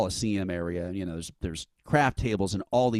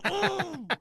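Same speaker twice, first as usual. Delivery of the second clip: high-pitched mock yelp of fright; startled gasp. Fan jumped by his hero, startled yelp.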